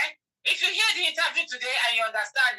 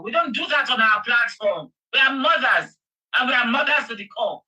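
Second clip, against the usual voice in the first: ordinary talk, nothing marked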